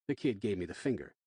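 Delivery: plain reading voice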